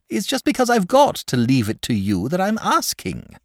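high, peevish retort; testy. A narrator's reading. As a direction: dragon snapping back at George, testy. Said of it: "replied the dragon rather testily".

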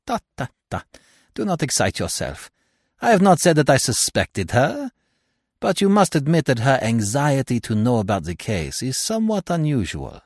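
lightly voiced French-accented detective; tut, then reason with him; Poirot. A narrator's reading. Detective calms his horrified friend, lightly accented and reasoning.

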